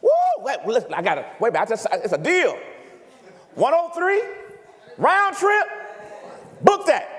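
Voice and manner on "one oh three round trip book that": excited bargain hunter. Retold or imitated. Preacher voicing a flyer thrilled at a cheap fare, excited.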